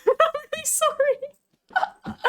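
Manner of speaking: high, squeaky laughing apology; real laughing apology. Real, not acted. Streamer admitting she is baffled by other women, laughing apology. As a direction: crack up while saying sorry.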